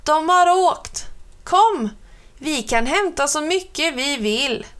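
excited call to his sister; excited shout. A narrator's reading. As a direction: shout the good news, thrilled. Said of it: "ropade han".

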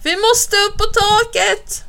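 high, slow, stretched urgent cry; alarmed cry. A narrator's reading. Bo urges Alf onto the roof, alarmed cry. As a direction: urge escape in panic. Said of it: "skriker han".

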